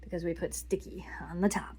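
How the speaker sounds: unvoiced whispered reminder; whispering. Real, not acted. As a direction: whisper it quietly.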